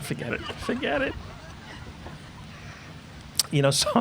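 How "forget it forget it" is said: sheepish mutter. Pretend. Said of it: "gives up on the joke".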